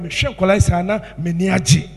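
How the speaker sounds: usual speaking voice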